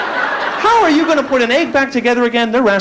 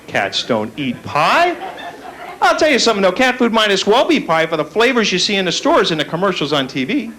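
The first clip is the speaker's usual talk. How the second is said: ordinary talk, nothing marked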